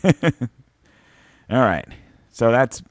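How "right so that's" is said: ordinary talk, nothing marked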